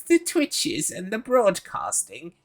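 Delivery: high, slow, quavering granny; sweet little old lady greeting. Retold or imitated. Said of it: "elderly lady voice".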